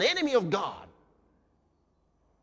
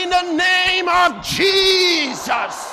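bold declaration; 8 semitones higher, 7 dB louder, much slower than usual. Shown as a demonstration. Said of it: "With joy".

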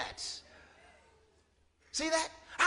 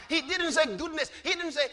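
ordinary delivery

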